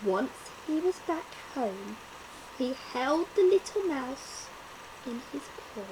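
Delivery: plain reading voice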